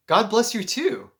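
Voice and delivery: slow, lifted, heartfelt return; touched reply. Retold or imitated. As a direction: bless them back eagerly. Acts out returning the blessing warmly.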